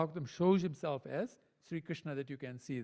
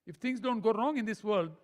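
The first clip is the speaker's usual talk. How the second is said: normal talking voice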